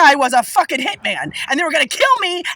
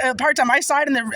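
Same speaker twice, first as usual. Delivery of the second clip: normal talking voice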